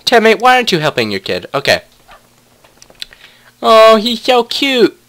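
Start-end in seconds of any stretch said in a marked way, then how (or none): 3.6-5.0 cooing. Real, not acted. Player cooing over her baby wolf pup, slow and tender.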